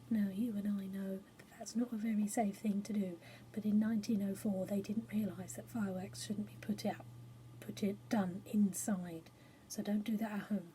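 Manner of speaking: confiding aside. Real, not acted. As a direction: lean in and whisper the safety note. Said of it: "aside to the children".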